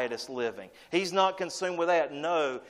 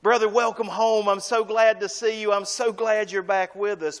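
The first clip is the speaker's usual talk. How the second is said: louder, higher glad greeting; joyful welcome; 5 semitones higher, 5 dB louder than usual. Retold or imitated. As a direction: call out a happy welcome home. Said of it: "hug his neck and give him a kiss and say".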